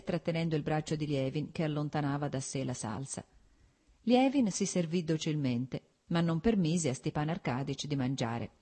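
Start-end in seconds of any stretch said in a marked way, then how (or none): none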